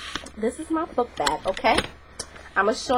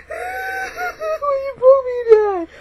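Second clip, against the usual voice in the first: very high, slow, crying whine; hammed-up wailing cry; 5 dB louder, much slower than usual. Poked girl wails at her attacker, slow mock crying. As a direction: wail it out like a hurt, whiny crybaby.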